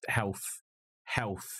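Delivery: "health" set apart by pauses, dropping in pitch; "health" dropped in quietly on its own, sceptical; heavy air quotes. Shown as a demonstration. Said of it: "very heavy air quotes".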